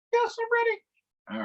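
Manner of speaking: very high, slow squeaky puppet voice. Pretend. Monkey puppet answering the host, squeaky and high.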